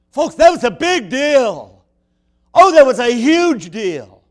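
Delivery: loud preaching. Acted. Preacher shouting the point.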